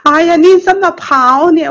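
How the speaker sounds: brother's surprised question. Retold or imitated. Brother asking why she ran.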